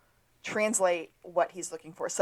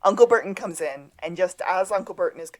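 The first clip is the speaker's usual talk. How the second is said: usual speaking voice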